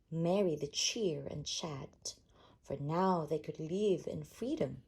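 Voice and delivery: ordinary delivery, nothing marked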